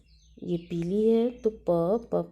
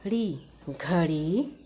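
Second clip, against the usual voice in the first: ordinary talk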